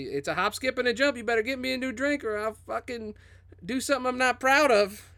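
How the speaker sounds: high-pitched Southern threat; Southern accent. Acted. Customer demanding a new drink from the bartender, Southern accent. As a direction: threaten in a put-on Southern twang. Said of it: "I could do a Southern accent".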